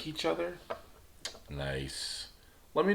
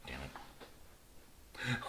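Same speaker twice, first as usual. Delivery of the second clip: whispered under the breath; hushed frustrated aside; muttered curse; 8 semitones lower, 16 dB quieter than usual. Real, not acted. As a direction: swear quietly to yourself after a slip.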